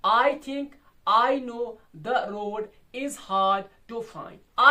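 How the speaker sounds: "I think, I know, the road, is hard, to find" slow, paused after each pair; loud, measured scansion of "I think I know the road is hard to find"; two-syllable beats. Shown as a demonstration. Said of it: "दो syllable हो गया एक metre".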